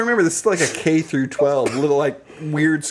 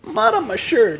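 retold upset protest, pitched much higher; upset kid; 12 semitones higher than usual. Kid confronting friend over mud on his shirt, upset. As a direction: reenact a high, aggrieved complaint. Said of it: "I was upset".

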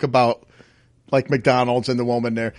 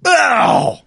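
sharp, high, louder yelp of pain; mom's sudden ouch; 10 semitones higher, 3 dB louder than usual. Retold or imitated. Mom yelps at the table, sudden high ouch. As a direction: yelp like she's been startled. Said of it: "my mom goes".

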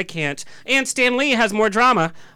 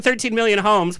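ordinary delivery

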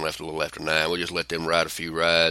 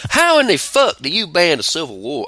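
incredulous; 10 semitones higher, 5 dB louder than usual. Real, not acted. Incredulous rant about banned film.

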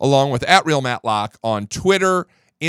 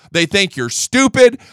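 usual speaking voice

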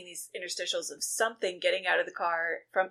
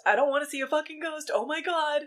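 fast, higher-pitched retold freak-out; mock panic; 8 semitones higher than usual. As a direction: play a friend panicking, voice jumping up. Acted panic in recap, escalating.

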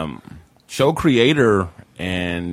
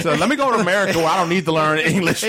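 mock exasperation; 11 semitones higher, faster than usual. Mocking joke about English.

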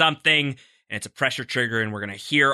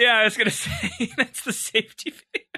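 words breaking into real laughter; can't hold the laugh in while talking; giggling through the line, higher; 10 semitones higher than usual. Real, not acted. Cracking up at co-host's joke.

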